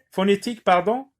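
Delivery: ordinary talk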